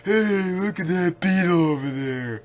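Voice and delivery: goofy dopey cartoon voice; mocking Kuma's dub voice. Showing fans how dub Kuma sounds, goofy dopey voice. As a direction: sound like a clueless idiot pointing at things. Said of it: "He literally sounds like that".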